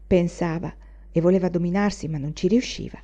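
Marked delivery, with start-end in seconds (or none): none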